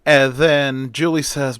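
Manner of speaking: ordinary delivery, nothing marked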